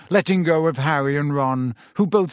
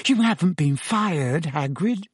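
higher, breath-caught question; gasped shock; 3 semitones higher than usual. A narrator's reading. Hermione fears Hagrid has been sacked, gasping. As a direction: gasp it out in alarm. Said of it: "gasped Hermione".

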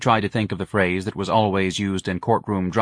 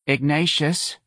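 tentative higher call, understated sadness; sad call; 6 semitones higher, slower than usual. A narrator's reading. Mother at her son's bedroom door, mildly sad. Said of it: "she called sadly".

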